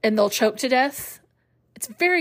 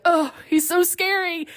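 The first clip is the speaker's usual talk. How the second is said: put-on higher, slower, a touch of childish fright; mock scared kid; 8 semitones higher than usual. A child under a blanket hearing Vader, mock frightened. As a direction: hide under the blanket and gasp it out. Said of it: "being like".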